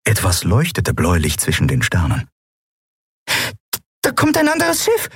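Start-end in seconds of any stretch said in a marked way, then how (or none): 4.0-5.1 very high, excited announcement; urgent report. A narrator's reading. Nelen spotting a ship, urgent report. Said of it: "meldete Nelen".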